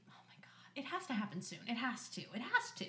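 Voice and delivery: soft, whispery thinking aloud; hushed conviction. Retold or imitated. Friend looking at couple's photo, hushed conviction. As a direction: murmur it like a secret hope. Retold private wish about engagement.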